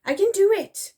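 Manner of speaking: high, loud irritated retort; snapped. A narrator's reading. Soldier refusing Dotty's help, snapped. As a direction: snap it back, pitched high. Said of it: "he snapped".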